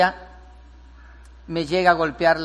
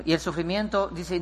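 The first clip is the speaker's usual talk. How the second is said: ordinary talk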